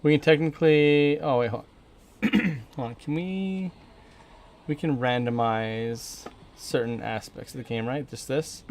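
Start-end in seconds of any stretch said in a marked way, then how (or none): none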